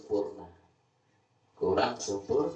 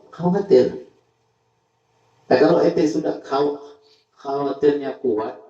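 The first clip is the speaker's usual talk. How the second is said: loud, higher preaching voice; fiery preaching; 5 semitones higher, 8 dB louder than usual. Acted. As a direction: preach it hard to the crowd. Preaching at full force. (khotbah berapi-api)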